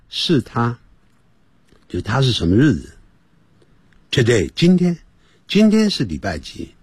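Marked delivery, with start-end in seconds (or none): none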